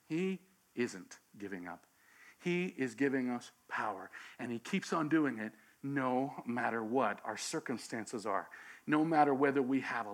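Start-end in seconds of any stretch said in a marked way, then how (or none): 0.1-8.5 quiet reassurance. Acted. Preacher drops voice for reassurance.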